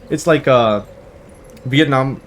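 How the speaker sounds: normal talking voice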